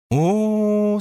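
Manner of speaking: high drawn-out sympathetic moan; a long high sympathetic "oh". A narrator's reading. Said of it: "сочувственно простонала Наэль".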